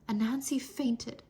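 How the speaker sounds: usual speaking voice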